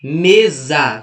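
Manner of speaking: "Mesa" repeated loud, leaning on ME; teacher proving the strong ME in "Mesa"; stress on first syllable. Shown as a demonstration. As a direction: punch and hold the ME of "Mesa". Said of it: "É me".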